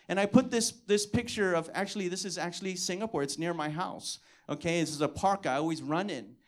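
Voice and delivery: ordinary talk, nothing marked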